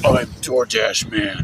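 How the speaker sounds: low mock-superhero voice. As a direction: announce yourself like a hammed-up caped hero. Playing a Batman-like hero.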